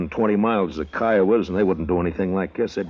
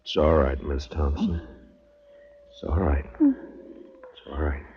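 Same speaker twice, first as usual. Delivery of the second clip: soothing; 3 semitones lower, slower than usual. Acted. Marshal soothing wounded woman.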